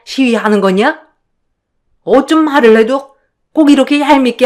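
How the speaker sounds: indignant, contemptuous in-law voice; scolding mother-in-law. Acted. Mother-in-law berates the eldest for talking back, indignant scolding. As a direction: put her firmly in her place.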